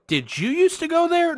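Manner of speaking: very high mock question; imitating nosy stranger. Woman polling next person in line, high mock question. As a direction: pipe the question up high, as her.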